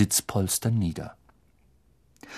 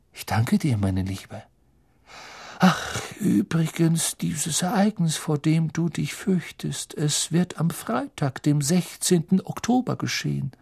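Professor Trelawney; 5 semitones higher than usual. Acted. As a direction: casually let slip a slow, ominous prediction. Trelawney prophesies to Lavender. (sanft gedehnte, unheilvolle Wahrsagerin)

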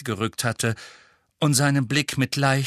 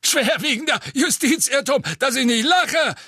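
higher, louder cracking voice; 14 semitones higher, 4 dB louder than usual. A narrator's reading. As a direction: let the voice crack in outrage. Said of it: "mit sich überschlagender Stimme".

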